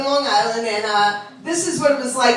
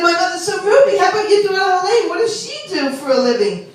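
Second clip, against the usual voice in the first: raised-pitch nosy friend; lightly voiced parlor gossip; beauty parlor lady; 6 semitones higher than usual. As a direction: quiz her about her daughter. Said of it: "But that's how they talk".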